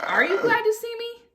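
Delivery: high, bright eager question; asking hopefully, voice jumping up; eager Amelia. Acted. Amelia asking the librarian eagerly, high and excited.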